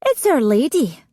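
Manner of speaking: lightly voiced girl, calm. A narrator's reading. Sheila naming Fiona to outlaws, calm. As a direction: say it simply and serene. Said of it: "answered Sheila calmly".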